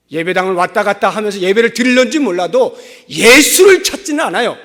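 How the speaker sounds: loud, high-pitched pulpit proclamation; full-force preaching. Acted. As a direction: build to a shouted sermon climax. (목청 높여 외치는 설교)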